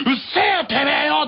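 high, hammed-up bratty yell; mock teen snap. Teen son to apologising father, mock angry snap. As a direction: snap back like a sulky teenager. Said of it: "って言った日には".

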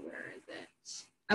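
whispered aside to herself, very soft; low whisper, almost off mic; muttered aside. Real, not acted. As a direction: breathe it under your breath while searching. Muttering to herself searching slides.

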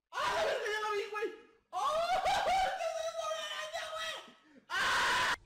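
high, panicked yelling at a spider; startled scream. Real, not acted. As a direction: shriek in sudden fright.

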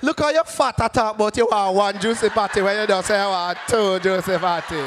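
rapid-fire Jamaican Patois scolding; mocking Jamaican lady. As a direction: tease in broad Jamaican Patois. Jamaican lady mocking his order.

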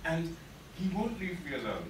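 plain reading voice

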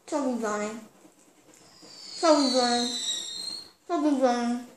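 garbled words through crammed cheeks; mumbling with mouth overfull; cheeks stuffed full. Real, not acted.

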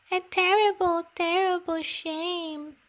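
high little lament; tiny mouse voice. Acted.

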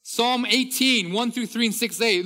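loud, high, ringing proclamation of the psalms; booming psalm reading over music; fervent scripture reading. Acted. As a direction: preach the verses out with full force.

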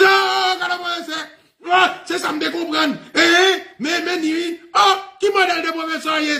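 shrieking falsetto imitation of a man losing his cool; mock-outraged, very high string of cries; agitated reaction. Student protesting a rude answer about professors, agitated. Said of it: "faut réagir comme ça, agité".

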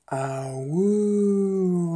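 drawn-out mock "ah-woo"; wolf howl. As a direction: play a wolf howling, stretched out. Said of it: "she whoop back".

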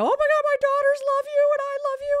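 very high, loud fangirl squeal; squealed, over-the-top adoration; starstruck gushing. Retold or imitated. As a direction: gush breathlessly at your idol, acting it out. Acting out gushing at an idol.

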